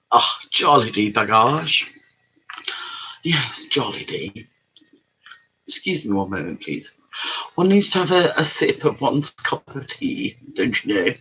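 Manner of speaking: jokey posh English voice over a cup of tea; mock posh English. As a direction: play a plummy English lady sipping tea, unhurried. A mock posh tea-sipping lady.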